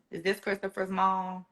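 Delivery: shaky, hushed caller. Retold or imitated. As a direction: hush it like bad news is coming. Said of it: "Her voice is shaking".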